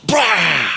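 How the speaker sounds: a short hammed-up rage blast; mock angry roar. Preacher mimics a tantrum God never throws, mock roar. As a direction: bark it like a sudden tantrum.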